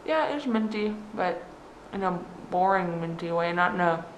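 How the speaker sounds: talking through toothpaste foam; while brushing teeth. Real, not acted. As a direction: speak with a mouth full of toothpaste. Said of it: "now that you got toothpaste in your mouth".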